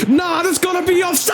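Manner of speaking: high-pitched yell of disbelief; protesting shout. Real, not acted. Gamer protesting a conceded goal, outraged shout.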